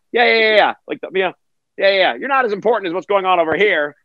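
mock distracted coworker; dismissive brush-off. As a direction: wave them off while busy elsewhere. Dismissive guy with big monitor.

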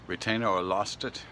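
ordinary talk, nothing marked

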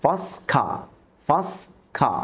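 ফসখা said as fas-kha, the t of first left out; first class with t dropped. Shown as a demonstration. Teacher showing students how the t drops, t silent. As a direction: glide first straight into class with no t. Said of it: "আমরা টি এবং ডির উচ্চারণ করব না".

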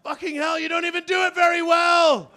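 high, loud scornful yell; high hammed-up scorn; mocking shout. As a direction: scoff at him loudly. Indian elephant mocks his voice.

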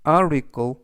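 article with the T softened to a flap r, "ari-kl"; flapped T in article. Shown as a demonstration. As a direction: article with the T as a light r. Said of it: "트보다는 르".

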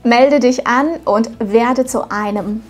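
ordinary delivery

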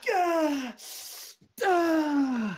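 loud, high theatrical groans; hammed-up agony; mock pained cries. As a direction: cry out as if bones are snapping. Groaning theatrically about brittle bones.

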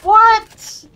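high loud startled what; shocked shout. Real, not acted. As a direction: blurt it out in disbelief. Sudden death in game.

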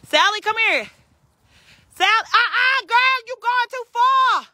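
loud high call across the yard; high carrying call. Real, not acted. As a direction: holler for the pig to come back, drawing out the last words. Calling her pig across the yard.

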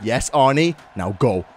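ordinary delivery

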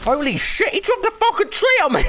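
high-pitched disbelieving yell; shocked outburst. Real, not acted. Gamer crushed by a falling tree, shrieking in shock.

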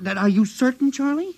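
can't believe it, pressing for certainty; alarmed disbelief. Real, not acted. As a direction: pitch jumps up on "Charlie?" in shock. [name] shocked the scientist is missing.

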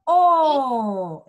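"O" called out loud and round; loud vowel demo. Shown as a demonstration. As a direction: model the O loudly for the children. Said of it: "díganlo".

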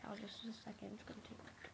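whispering. Real, not acted. Mocking friend on phone.

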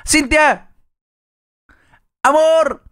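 llamado agudo, sostenido; llama a tu pareja a la otra pieza; llamando a alguien. Real, not acted. (llamando en voz alta)

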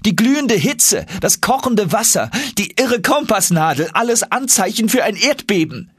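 high, eager youthful voice; young Axel voice. Acted. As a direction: play the excited young nephew.

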